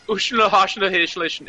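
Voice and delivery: high mock Swedish babble; mock Swedish gibberish. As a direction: imitate friends slipping into Swedish. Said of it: "then one would be like".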